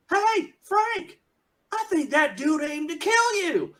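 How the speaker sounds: put-on cocky young outlaw taunting. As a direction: jeer at the old man like a cocky gunslinger. GM voices laughing outlaw.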